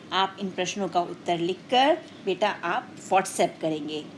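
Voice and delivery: ordinary talk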